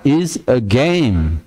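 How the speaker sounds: "is a game" very slow; slow demo. Shown as a demonstration. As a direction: stretch "is a game" out. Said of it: "Tuh, ada e-nya". ("is a game" diucapkan sangat pelan)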